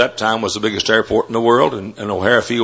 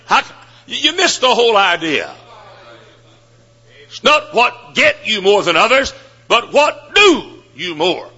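high, pressing sermon voice, slowing on the last words; impassioned preaching; 10 semitones higher than usual. Acted. Preacher chiding graduates who only want more money, high and urgent. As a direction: drive the point home from the pulpit. Preacher scolds and exhorts students.

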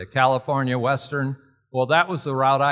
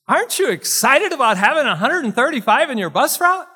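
loud, high, prodding enthusiasm; Marlene Evans; 12 semitones higher, 9 dB louder than usual. Retold or imitated. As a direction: imitate a loud teacher demanding excitement. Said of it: "she liked to talk loud".

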